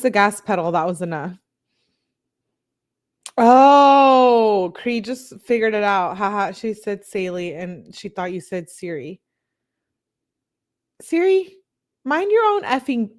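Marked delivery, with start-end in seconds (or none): 3.4-4.7 loud, higher oh of realization; real surprise; loud surprised oh. Real, not acted. Sudden realization. 11.0-13.1 higher, playful telling-off of Siri; half-joking scolding; mock scold. Scolding phone assistant.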